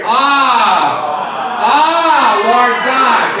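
loud, high, impassioned call to God; emotional cry. Shown as a demonstration. Preacher getting church to repeat Jeremiah's words, emotional cry. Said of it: "you gotta put some emotion in this".

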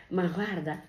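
ordinary talk

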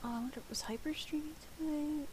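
soft whispered wondering; whispering. Real, not acted. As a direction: whisper the question to yourself. Musing to herself.

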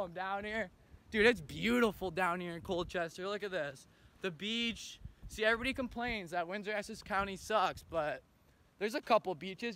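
ordinary talk

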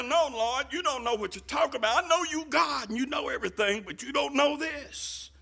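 high, hurried objection; acted-out disciple contradicting his master; insistent protest. Preacher acts out Peter contradicting Lord.

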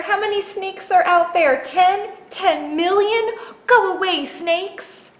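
very high, loud, frantic voice; panicking Square. Acted. Square imagining snakes outside the door, high panic. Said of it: "Square was afraid of snakes".

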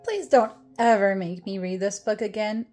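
usual speaking voice

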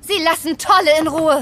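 loud, firm bust; confronting. Acted. Detectives bursting in to arrest the thief, loud. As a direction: call out the thief.

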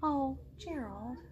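ordinary delivery

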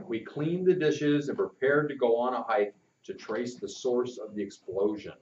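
ordinary delivery

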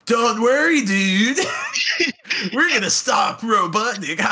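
laughing surfer dude shout. Retold or imitated. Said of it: "surfer voice for Manic".

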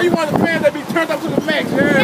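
high, loud hype for fans; hype shout. Real, not acted. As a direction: get the crowd turned up. Hyping the fans.

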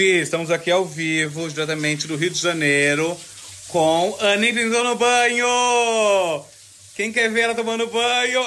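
building to high, loud drawn-out announcing; mock live announcer. Friend presenting [name]'s bath live, hammed-up announcer voice. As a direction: announce it like a hyped live show.

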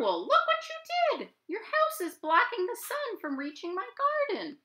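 very high, squeaky rabbit voice; Rabbit upset. Acted. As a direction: complain shrill as Rabbit. Rabbit scolds Owl.

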